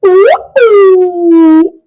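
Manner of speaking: high, loud monkey ooh calls; full-throated ape hooting; monkey hoots. Shown as a demonstration. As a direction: hoot it out like a monkey. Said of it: "Do it with me".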